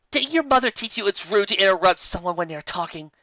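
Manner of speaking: high, loud, indignant scold; disgusted scolding. Acted. Lyra scolding Twilight for interrupting, loud and indignant. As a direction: snap at her like a scandalised teacher. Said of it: "looked at Twilight in disgust".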